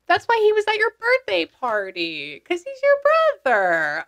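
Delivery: high-pitched exclamation, drawn to a falling end; sudden realization. Real, not acted. Medium realizes he's the client's brother, high-pitched realization.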